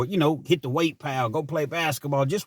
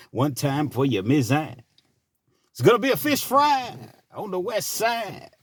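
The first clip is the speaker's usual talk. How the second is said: radio DJ voice. Acted. Late night DJ on W T L A, radio voice. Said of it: "put my radio voice on".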